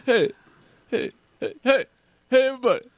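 high-pitched celebrity parody; hammed-up high announcement; John Travolta knockoff. Mock star rallying everybody as champions, high put-on voice. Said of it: "You sound like a knockoff of John Travolta".

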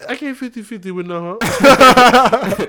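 mock cheap date.

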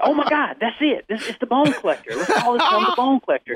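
high-pitched, laughing burst of discovery; shrieking with joy at the perfect name; excited eureka. Retold or imitated. Guest reliving the moment he named the gun, high and laughing. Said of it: "I'm like".

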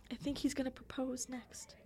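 hushed, starry-eyed whisper; awed whisper. Retold or imitated. Girl thrilled by a cupcake necklace, whispering in awe. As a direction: breathe it like a secret wish.

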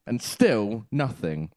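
ordinary talk

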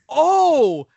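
high, loud "oh" of dawning realization; sudden revelation. Retold or imitated. Customer realizing his mistake before clerk, loud revelation. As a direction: let the penny drop loudly. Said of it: "I have this asshole revelation".

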